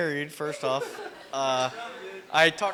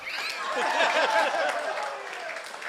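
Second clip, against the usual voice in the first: high breathy giggle; 12 semitones higher than usual. Real, not acted. Laughing after toast joke.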